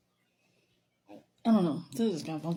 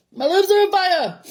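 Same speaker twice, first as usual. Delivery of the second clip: loud, high cry of burning; real shout; 9 semitones higher, 8 dB louder than usual. Real, not acted. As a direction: yell out as the spice hits. Spicy food burning her lips.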